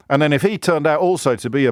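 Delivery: ordinary delivery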